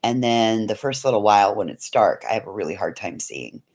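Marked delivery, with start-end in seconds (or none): 2.3-3.6 lower, softer echo of the line; dropping to a low murmur; quiet repeat. Real, not acted. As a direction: say it again under your breath.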